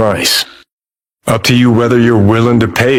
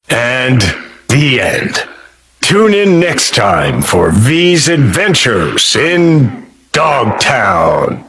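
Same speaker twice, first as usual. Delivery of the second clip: mock show announcer; 2 semitones higher, slower than usual. Game character wrapping up the adventure, mock announcer. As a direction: sign off like a serial's announcer.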